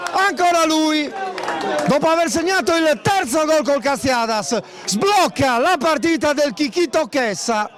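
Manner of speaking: breathless italian goal commentary. Real, not acted. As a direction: shout the goal with everything. Bosa scores the opening goal.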